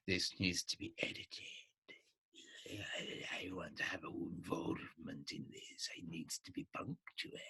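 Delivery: fading to a low hushed whisper; ego's voice. Retold or imitated. Inner ego nagging the writer to edit, hushed whisper. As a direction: voice the inner critic creeping in, hushed. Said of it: "ego control coming in saying".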